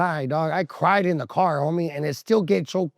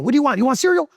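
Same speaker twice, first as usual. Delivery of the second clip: high, loud, impatient questions; acted exasperation, pitched way up; impatient hurrying; 12 semitones higher, 6 dB louder, faster than usual. Grandson rushing grandma down the aisles, impatient high voice.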